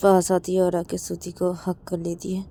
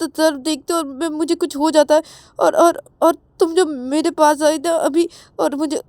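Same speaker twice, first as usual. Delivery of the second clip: put-on flustered girl stuttering, rising higher toward the end; panicked stutter; 10 semitones higher, 11 dB louder than usual. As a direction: stumble on every word as the panic grows. (घबराहट में हकलाती, आवाज़ ऊँची होती हुई)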